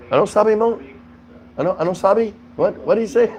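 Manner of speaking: lost, turning to ask what was said; confused Filipino listener. Retold or imitated. Host mimics Filipinos missing a joke, high and puzzled. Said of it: "they look at someone next to 'em".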